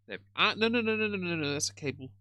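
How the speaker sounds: sudden high alarmed protest; real startle as the cable yanks; startled no no no. Real, not acted. Bingo pulls headphone cable.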